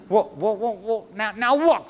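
high, loud, stammering; flustered protest. A narrator's reading. Charlie facing the angry old man, flustered. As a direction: stammer loudly, trying to get a word in.

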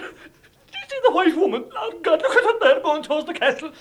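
high, loud, strained alarm; frightened cry. Acted. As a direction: point at the ghost in fright.

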